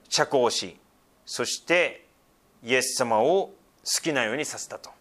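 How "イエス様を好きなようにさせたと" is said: ordinary talk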